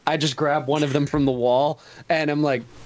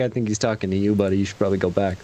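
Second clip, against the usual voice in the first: low, confidential advice to a neighbor; low-voiced in-character aside; prisoner's aside; 8 semitones lower, faster than usual. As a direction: drop the pitch and pass it on discreetly. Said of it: "and I'm like".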